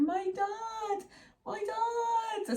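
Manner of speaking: put-on high whiny child calling out, near tears; toddler calling dad. Toddler in bed calling for her dad, high and whiny. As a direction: wail for daddy like a little girl.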